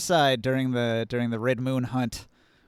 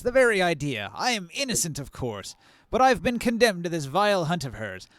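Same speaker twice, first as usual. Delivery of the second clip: indignant noble merchant voice, raised pitch; pompous merchant; 5 semitones higher than usual. Acted. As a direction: play a put-upon aristocratic trader.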